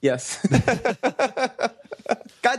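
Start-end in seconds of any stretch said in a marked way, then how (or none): 0.5-2.6 laughing at own mistake; high, laughing groan of embarrassment. Real, not acted. Embarrassed at mixing up episodes.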